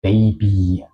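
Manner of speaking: put-on "Baby" with the final y as a long ee; I larga final. Shown as a demonstration. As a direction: say "Baby" as "bei-bii". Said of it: "suena un poco más como una I larga". ("Baby" con la Y final como i larga)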